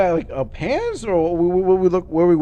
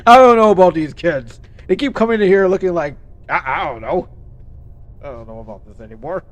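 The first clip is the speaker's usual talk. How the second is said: mock principal, louder; 7 dB louder than usual. Imitating the principal again, louder and more exasperated. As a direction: start loud and give up by the end. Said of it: "It's like".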